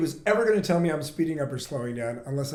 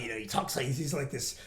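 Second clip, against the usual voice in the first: ordinary talk, nothing marked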